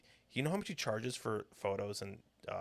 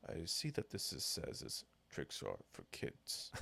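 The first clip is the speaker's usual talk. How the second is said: very low, slow, breathy deadpan; grave movie voice; 7 semitones lower, 8 dB quieter, slower than usual. Retold or imitated. Host retells the Ted cashier scene in a deep slow voice. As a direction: deliver it like a stern action hero. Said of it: "and he goes".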